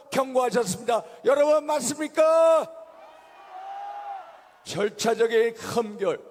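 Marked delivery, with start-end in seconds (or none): 1.2-2.7 rally cry, rising to a shout; rousing shout. Real, not acted. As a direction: shout it to the crowd. Rally call to crowd.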